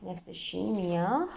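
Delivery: ordinary talk, nothing marked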